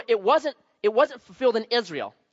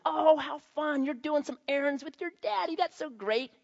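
put-on much higher, syrupy lady voice cooing at a child; gushing church lady; 9 semitones higher than usual. Church lady cooing at the pastor's daughter, high and gushing. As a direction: coo at the little girl like a sugary church lady. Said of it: "the wife looks down at my daughter and says".

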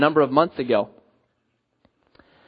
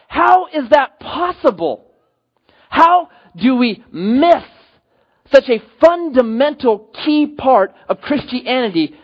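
shocked disbelief; 6 semitones higher, slower than usual. Retold or imitated. Shocked pastor retelling his thoughts.

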